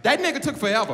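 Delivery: put-on high-pitched toddler blurting out; high little-kid voice; imitated toddler. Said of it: "My baby be like".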